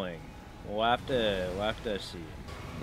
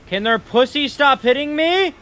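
high, loud exasperated complaint; exasperated yell; 8 semitones higher, 6 dB louder than usual. Real, not acted. Gamer raging at the game for hitting him, exasperated. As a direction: yell it in exasperation at being hit. Frustrated gamer yelling at hits.

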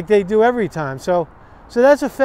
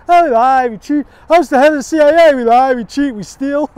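mock boastful confession, a bit higher and louder; imitating Pompeo; 5 semitones higher, 5 dB louder than usual. Mimicking Pompeo bragging about the CIA, mock boastful. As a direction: brag about lying like a smug spy chief. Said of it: "you know, Mike Pompeo".

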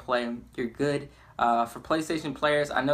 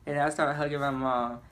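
normal talking voice

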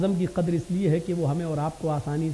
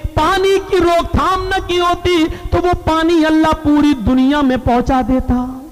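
loud, ringing pulpit oratory; full-force preaching; 7 semitones higher, 8 dB louder than usual. Retold or imitated. Preacher quoting the Prophet's sermon from the pulpit, full-force preaching. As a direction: proclaim it with sustained raised voice.

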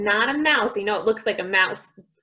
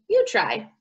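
usual speaking voice

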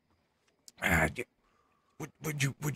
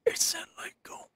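whispering. Real, not acted. Aside whispered to friend.

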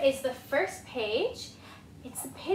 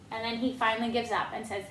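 ordinary delivery, nothing marked

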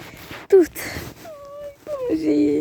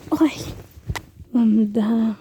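usual speaking voice

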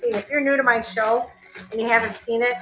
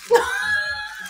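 burst of laughter. Real, not acted. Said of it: "You burst out laughing".

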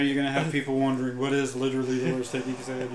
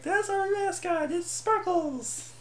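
very high, slow cutesy voice; squeaky mascot voice; slower than usual. Pretend. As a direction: announce the mascot like a cartoon. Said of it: "and then be like".

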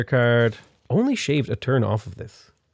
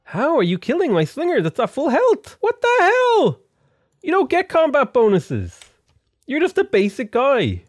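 much higher, outraged squeal; outraged disbelief; 12 semitones higher than usual. Real, not acted. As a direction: shriek in indignant disbelief, dragging out the hell. His unit dies at full health.

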